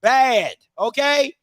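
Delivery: hyped slow disbelief at the quarterback; emphatic rant. Real, not acted. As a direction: lean hard on every word. Ranting about the quarterback.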